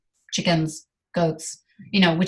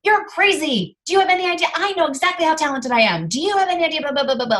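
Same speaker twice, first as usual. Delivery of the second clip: indignant retort; 8 semitones higher, faster than usual. Retold or imitated. Retold defensive retort to friend.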